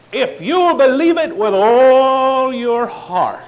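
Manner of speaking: loud, high, slow hammed-up televangelist; mocking TV preacher. Mimicking TV preachers to his congregation, hammed-up. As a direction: ham up a prosperity preacher's pitch. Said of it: "They take this scripture and they say".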